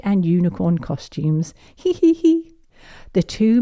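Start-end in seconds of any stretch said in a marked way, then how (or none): 1.7-2.5 high squeaky giggling hee hee hee; high giggle. Acted. Narrator voicing the girls' giggles, high and squeaky.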